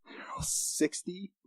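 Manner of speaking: slow, whispered shocked question; stunned, breath-only question; hushed disbelief. Acted. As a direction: ask it under your breath, drawn out. Stunned reply to a revelation.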